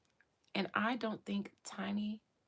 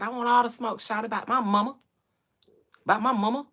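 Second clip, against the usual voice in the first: Atlanta rapper impression; 9 dB louder, faster than usual. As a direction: hype it up like an A-Town rapper. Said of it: "King said".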